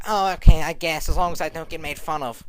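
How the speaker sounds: lightly voiced girl, reluctant okay; a touch of a shy girl giving in; Edith. Edith agreeing to prom, lightly voiced reluctant girl. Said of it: "She's like".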